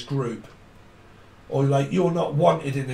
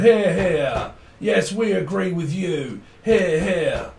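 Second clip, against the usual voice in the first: a hint of a courtroom crowd, higher and louder; mock court cheer; 4 semitones higher, 3 dB louder, slower than usual. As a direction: cheer it out like approving members in court. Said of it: "like, going to court and going".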